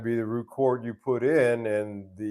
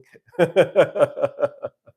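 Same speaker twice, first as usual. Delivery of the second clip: amused laugh; 9 semitones higher, 11 dB louder than usual. Real, not acted. Laughs at ice-skating ducks.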